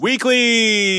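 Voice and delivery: loud high announcer echo of the word. Acted. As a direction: punch it like an ad sidekick. Cohost echoes announcer.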